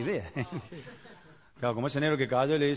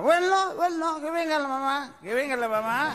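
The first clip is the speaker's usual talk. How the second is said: put-on drunk voice; 10 semitones higher than usual. Drunk husband answers wife.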